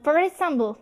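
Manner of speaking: ordinary talk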